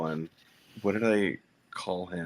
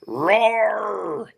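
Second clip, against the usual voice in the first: sudden high loud roar; mock roar; 12 semitones higher, 7 dB louder than usual. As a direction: let out a playful growl of rage. Mock roar mid sentence.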